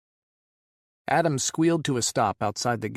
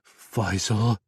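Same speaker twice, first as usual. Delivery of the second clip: louder, drawn-out moan; 5 dB louder than usual. Acted. Adam moaning Faisal's name down the line. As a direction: call his name in anguish. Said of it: "he moaned".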